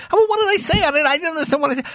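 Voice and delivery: put-on high fast pleading kid; innocent kid. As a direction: rush it like a boy who swears he meant nothing. Boy protesting innocence.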